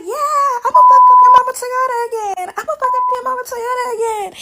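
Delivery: high, loud imitated yell; mock furious threat. Kalani threatening Drake while beating him, high mock yell.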